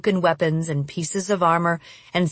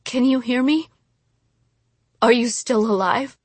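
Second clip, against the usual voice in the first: higher, worried questions; worried, rising voice; anxious questioning; 5 semitones higher than usual. A narrator's reading. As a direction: plead for a sign of life. Checking if fallen friend lives.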